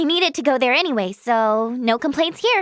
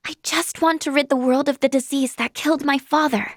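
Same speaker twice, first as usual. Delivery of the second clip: ordinary talk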